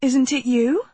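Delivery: tentative question with a steep final rise; hesitant, searching for recognition; uncertain. A narrator's reading. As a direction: ask unsurely, lifting high at the end. Uncertain question to supposed friend.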